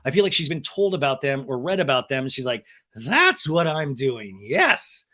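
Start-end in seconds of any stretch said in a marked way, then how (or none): none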